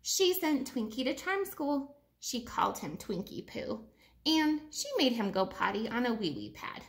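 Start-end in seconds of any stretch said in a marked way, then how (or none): none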